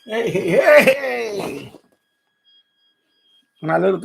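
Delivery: ordinary talk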